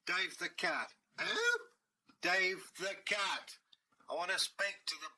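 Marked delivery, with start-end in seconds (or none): 1.2-1.7 high squeaky puzzled who; a startled doorkeeper, pitched way up. Acted. Doorkeeper baffled by a cat's name, high squeak.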